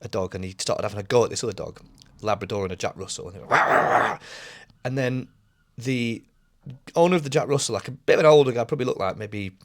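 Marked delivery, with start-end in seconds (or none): 3.5-4.2 rough mock dog snarl; noisy "wah wah" dog impression; imitated dog barking. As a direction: imitate a dog kicking off at another. Imitating dogs going at each other.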